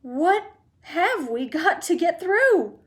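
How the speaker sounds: put-on high, slow, loud worried old man; old man fretting aloud; alarmed old man. A narrator's reading. Grandpa Joe fretting to Mr Wonka, high slow worry.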